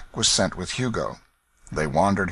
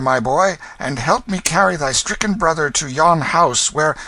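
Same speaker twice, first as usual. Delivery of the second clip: kindly gentleman; 8 semitones higher, 5 dB louder than usual. Acted. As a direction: soothe the poor lad. Stranger gives money.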